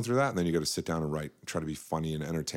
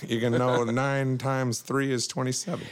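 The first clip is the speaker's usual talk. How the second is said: pitched way up, playful; high-pitched voice; 6 semitones higher than usual. Real, not acted. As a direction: voice jumps up high. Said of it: "our voices just went here".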